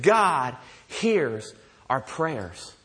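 very slow, low, weighty statement; letting it sink in. Real, not acted. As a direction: space out "God hears our prayers" so each word lands. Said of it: "Let that sink in a little bit".

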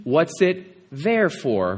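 slow, pointed "What's it there for?" echoing "therefore"; teacher's pun, spelled out slowly; wordplay on therefore. Shown as a demonstration. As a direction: drag out "there for" to mirror "therefore". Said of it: "you should ask the question".

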